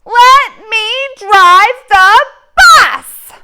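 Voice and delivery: slow, high, furious yell; angry shout. Acted. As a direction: scream it in a tantrum. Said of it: "Oh, he's very angry".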